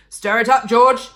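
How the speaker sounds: loud, high urging shout. A narrator's reading. Mister Cranky urging George at the saucepan, loud shout. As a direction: bellow the order. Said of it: "shouted Mister Cranky".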